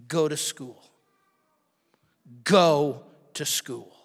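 voice of God. Retold or imitated. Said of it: "God just said to me".

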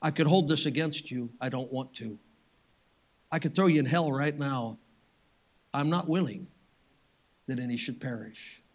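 soft, low, compassionate voice; quietly withhold the punishment, sincere and tender; gentle mercy. Acted. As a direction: let each phrase fall away softly. Voices Jesus choosing compassion over wrath.

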